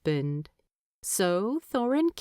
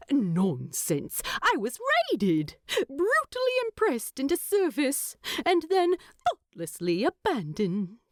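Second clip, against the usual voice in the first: higher, indignant hobbit teasing his husband; mock outrage, pitched up; voiced Bilbo; 7 semitones higher than usual.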